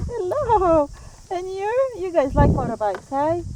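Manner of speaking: bright pet voice, still shaken; excited. Real, not acted. Greeting dogs after a fright.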